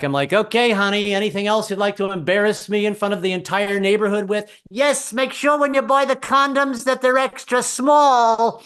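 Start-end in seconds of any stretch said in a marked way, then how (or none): 0.3-4.5 loud, sarcastic yell back up. Retold or imitated. Husband yelling back up to wife, mock sarcastic. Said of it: "I'd have to yell back". 4.7-8.6 high, slow, loud yell; yelling out the window. Retold or imitated. Wife yelling from window, high slow and loud. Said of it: "she'd have to yell out the window".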